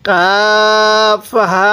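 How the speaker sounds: কাফ হা with the vowels stretched very long; long-held কাফ হা in chanted recitation; drawn-out recitation. Shown as a demonstration. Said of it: "ইয়ায়ের মধ্যে টান দেন".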